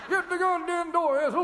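put-on grumpy old man yelling for Ethel; cranky yell. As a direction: holler at the wife across the house.

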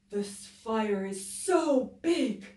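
loud, slow, drawn-out howl; howling wolf. Acted. Wolf wails at the huge fire, howling. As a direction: stretch the words like a wolf howling. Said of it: "howled Wolf".

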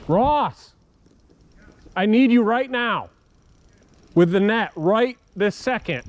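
loud, high calls for help downriver; urgent shouting. Real, not acted. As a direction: yell for your buddy to bring the net now. Yelling for friend with net.

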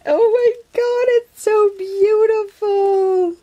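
overwhelmed real delight; delighted squeal. Real, not acted. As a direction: gush like it's the best gift ever. Thrilled by the gift.

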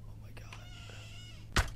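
breathless, slow whisper of dread; hushed horror whisper; terrified whisper. Real, not acted. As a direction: barely breathe it out.

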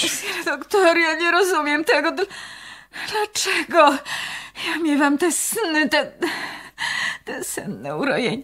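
high, trembling, confused voice; tearful plea. Acted. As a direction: beg for an answer near tears. Nun pleading, bewildered.

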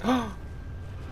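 gasp. Real, not acted. Sudden kill in the fight.

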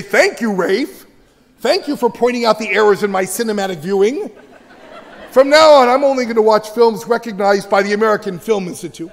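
laughing, hammed-up fake gratitude; sarcastic kid voice. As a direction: play the kid thanking him with heavy sarcasm. Mock grateful kid, sarcastic.

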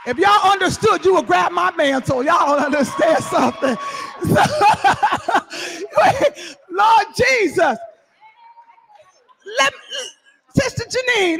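loud, high preacher's call to the congregation; preaching at full force. Acted. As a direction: preach it out, raising the roof.

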